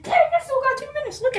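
high strained. Real, not acted. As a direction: squeak it out under strain. Enduring ice.

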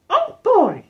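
loud, high falsetto cartoon mouse; terrible Mickey Mouse. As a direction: badly fake a cheery mouse in squeaky falsetto. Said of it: "that's my terrible Mickey Mouse impression".

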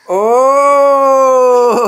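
high, loud, long "ooooh!"; excited "oh". Real, not acted. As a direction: cheer with one long high "oh". Big reaction as child stands.

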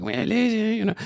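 Consuelo. Retold or imitated. Husband showing the next stage of wife's voice, Consuelo-like accent. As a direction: squeak it in a put-on Mexican accent. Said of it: "it became a little like Consuelo almost".

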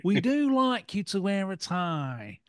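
slow, drawn-out Clough delivery; slowed Clough impersonation; Brian Clough impression. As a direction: savour each word like the old manager. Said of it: "that Clough impression was outstanding".

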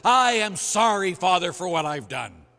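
hammed-up whining apology; crocodile tears. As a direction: fake a tearful sorry. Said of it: "cry crocodile tears".

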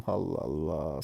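slow, soft, drawn-out exasperation; exasperated sigh. Real, not acted. Streamer sighing at his hopeless teammates. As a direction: groan it out, begging for patience. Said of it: "Sabır ver".

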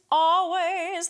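"always" started with a glottal catch, sung high; "always" with a firm glottal start; glottal onset. Shown as a demonstration. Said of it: "that starts with a vowel".

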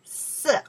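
色 high, dropping quickly; teacher's isolated falling 色; fourth tone. Shown as a demonstration. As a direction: cut 色 short with a falling tone. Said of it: "The four tones".

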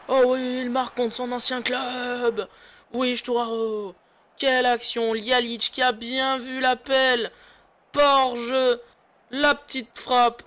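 excited play-by-play commentary; esports caster. Acted. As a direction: call the goal like a caster. Live play-by-play after a goal.

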